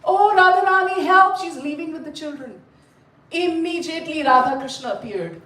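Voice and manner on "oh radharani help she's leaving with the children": high-pitched, mock-helpless wail for help; panicked plea. Simple-hearted husband begging for help as wife leaves, acted panic. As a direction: call out in hammed-up distress. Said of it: "He started".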